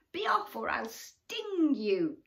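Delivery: furious warning. Acted. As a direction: warn the shark off, fierce. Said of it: "waving her tentacles furiously".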